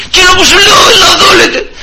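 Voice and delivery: high mock yell, cutting in; re-enacted high-pitched tirade; imitated angry shouting. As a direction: bellow an order as the irate man. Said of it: "Και φώναζε, φώναζε εκεί".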